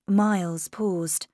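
plain reading voice

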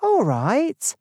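high-pitched, lilting agreement; obliging librarian. A narrator's reading. Librarian agrees not to fetch Carswell, high obliging voice. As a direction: give way in a light high voice. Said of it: "said the librarian".

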